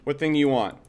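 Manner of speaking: ordinary delivery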